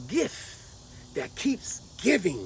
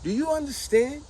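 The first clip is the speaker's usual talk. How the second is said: ordinary delivery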